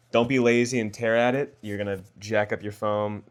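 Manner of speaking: ordinary talk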